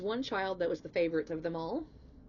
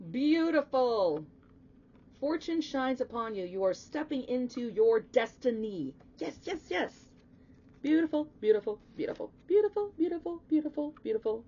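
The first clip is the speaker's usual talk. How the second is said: loud, high-pitched delight; real elation; 5 semitones higher, 6 dB louder than usual. Real, not acted. Tarot reader sees fortune shining on Leo, elated. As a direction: gush over wonderful news, pitch soaring.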